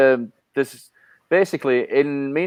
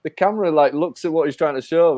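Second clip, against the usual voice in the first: usual speaking voice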